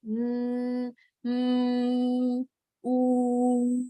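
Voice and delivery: sustained, level "mm" and "ooh"; soft ending. Shown as a demonstration. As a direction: let "mm" and "ooh" ring on without stopping. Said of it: "the sound go on like".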